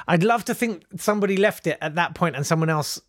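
ordinary delivery

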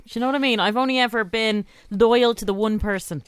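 usual speaking voice

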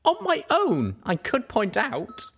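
Pip's retort. Acted.

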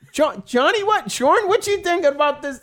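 high mock executive voice; producer asking a colleague. Producer asks colleagues about the Scouse idea, high mock voice. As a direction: lightly impersonate a producer checking with colleagues.

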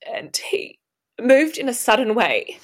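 ordinary talk

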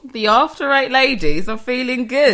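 hammed-up cheerful host voice; presenter-style announcement. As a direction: announce it brightly to your listeners. Hosts announce upbeat summary to listeners.

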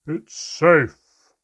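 huge loud call from below; booming. A narrator's reading. The mermaid booming up from far below, very loud. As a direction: boom it up from the depths. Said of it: "Havfrey's voice boomed from far below".

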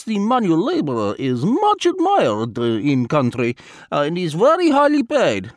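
put-on lightly accented, formal and unhurried Vladimir; a polite spy choosing words with care; foreign diplomat voice. A narrator's reading. Vladimir's long explanation voiced.

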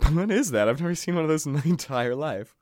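ordinary talk, nothing marked

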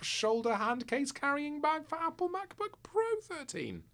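rising exasperation. Acted. Reading an absurdly long product name.

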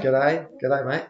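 a half-hearted Australian accent on "G'day", rising at the end; a light mock Australian "G'day". Shown as a demonstration. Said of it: "Do you wanna give us an Australian accent?".

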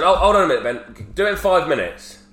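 retold calling out. Dad stopping his son before the speech, calling out. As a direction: call out to halt someone, voice jumping up.

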